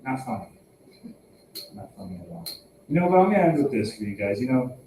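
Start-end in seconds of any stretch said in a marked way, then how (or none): 0.0-2.5 fading deadpan. Real, not acted. Deadpan dismissal, dropping away.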